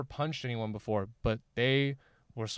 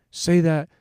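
slow, raised mock-drunk dare; drunk challenge; 5 semitones higher, 7 dB louder, slower than usual. Drunk friend challenges his heckler, slow, loud mock dare. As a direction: dare him loudly, drawing the words out. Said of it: "like, super drunk".

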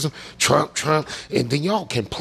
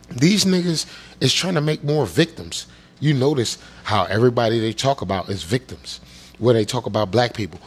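ordinary delivery

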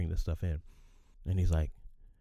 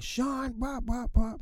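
high mock rant calling his name; mock yelling; much slower than usual. Boss fake-yelling at Sean for show, high mock rant. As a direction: parody the boss shouting nonsense. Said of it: "then he stops yelling".

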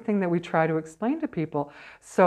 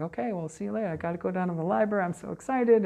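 breezy goodbye; 3 semitones higher, faster than usual. Retold or imitated. Brushing off spirits to leave.

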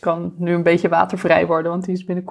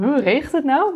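surprised; 7 semitones higher than usual. Retold or imitated. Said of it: "We zagen helemaal verbaasd van".

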